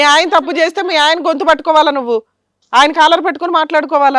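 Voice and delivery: indignant scolding. Real, not acted. Host scolds the woman in quarrel. (కోపంగా మందలిస్తూ)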